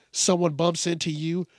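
normal talking voice

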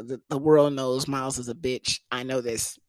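ordinary talk

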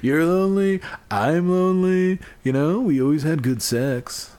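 hammed-up phone-call coaxing; mock pleading proposition. Echoing and acting the imagined call.

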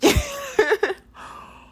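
a sudden high cackle. Real, not acted. Laughing at her own divine rage, high cackle.

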